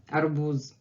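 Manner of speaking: low "arbuz" with a buzzing z at the end; voiced final z. Shown as a demonstration. Teacher repeats what she's not saying, ending in voiced z. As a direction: voice the last sound of "arbuz" as z. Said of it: "I'm not saying that".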